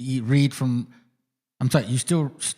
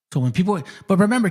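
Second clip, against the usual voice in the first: ordinary talk, nothing marked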